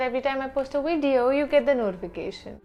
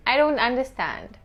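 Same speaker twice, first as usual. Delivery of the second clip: ordinary talk, nothing marked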